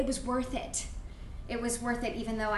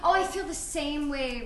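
usual speaking voice

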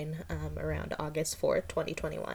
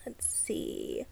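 whispered aside; 8 dB quieter than usual. Real, not acted. Thinking aloud mid-sentence.

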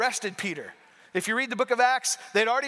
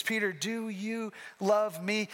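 tender slow question; 5 dB quieter, slower than usual. Acted. Jesus asks a third time.

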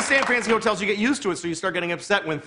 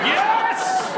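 very high, drawn-out squeal of yes; mock triumph. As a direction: squeal with exaggerated delight. Delighted at promised investigation.

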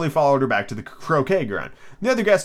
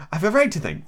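mildly sharp, higher-pitched Alice; pitched up, lightly indignant; sharp retort; 6 semitones higher than usual. A narrator's reading. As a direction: answer back with a touch of sharpness. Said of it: "said Alice sharply".

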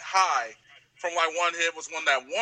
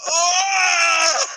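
long, high, loud mock wail; acted-out wail; 11 semitones higher, 8 dB louder than usual. Comic acting out the biggest hit, loud mock wail. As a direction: blast out a drawn-out, exaggerated "oh".

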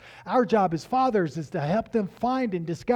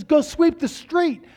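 stern dad order; 10 semitones higher, 6 dB louder, slower than usual. Retold or imitated.

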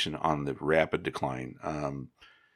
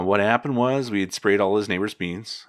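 normal talking voice

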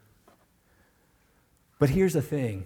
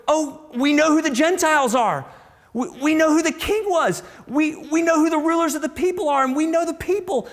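much higher and louder, racing, smug certainty; mock triumphant gloating; 8 dB louder, faster than usual. Preacher mimics scholars gloating loud and fast over the verses. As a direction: crow it like you've cracked the code.

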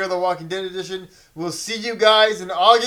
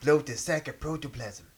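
cartoon quote; 4 semitones lower, 8 dB quieter, slower than usual. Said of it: "You don't know Ren and Stimpy?".

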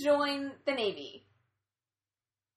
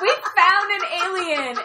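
loud, higher, slowed mock-excited blurt; hammed-up excited shout, higher and louder; excited announcement; 6 semitones higher, 10 dB louder than usual. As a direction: announce it like a proud kid who caught something. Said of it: "awkwardly gestures at the alien, like".

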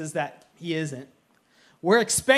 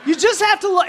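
higher, louder mocking laugh-through echo; 8 semitones higher, 5 dB louder, faster than usual. Pastor mocking his wife's answer, higher and laughing. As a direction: throw her words back with a laugh.

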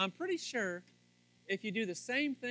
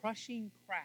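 loud, high, building sermon; preaching; 4 semitones higher, 7 dB louder than usual. Real, not acted. Pastor preaching to husbands at full force. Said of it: "I'm getting a little preachy there".

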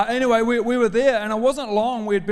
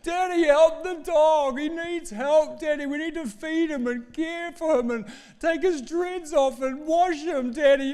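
begging daughter; 8 semitones higher than usual. Retold or imitated. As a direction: imitate a little girl begging her dad, stretching the words. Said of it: "she begged me".

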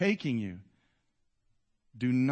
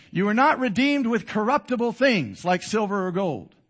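loud, raised-pitch scripture proclamation; emphatic preaching; 7 semitones higher, 7 dB louder than usual. Real, not acted. Preacher proclaiming Peter's verse to the congregation, emphatic. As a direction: drive the verse home, loud and high. Restarts verse at full force.

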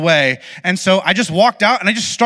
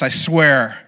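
ordinary talk

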